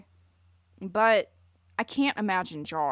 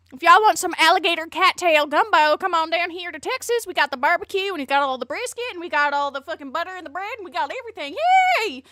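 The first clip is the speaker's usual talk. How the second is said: a hammed-up Texan who turns to insults; over-the-top Southern voice, shouted, much higher; mock Southern accent; 8 semitones higher, 7 dB louder than usual. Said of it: "I can't do it".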